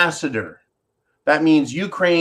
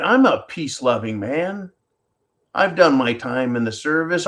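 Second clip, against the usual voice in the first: normal talking voice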